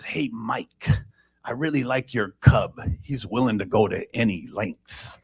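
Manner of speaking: hushed, dry aside; whisper. Retold or imitated. Frank whispering the joke to the sponsor. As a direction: lean in and whisper it to Mike. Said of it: "he says".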